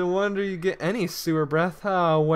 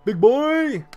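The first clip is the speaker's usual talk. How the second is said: character voice; 7 semitones higher, 7 dB louder than usual. Acted. Voicing game character exclaiming.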